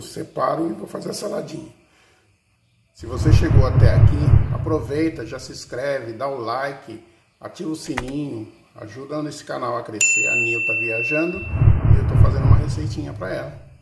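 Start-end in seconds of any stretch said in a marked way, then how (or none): 2.9-13.7 louder, upbeat subscribe pitch; channel plug. Acted. Cook urges viewers to subscribe and like, upbeat louder plug.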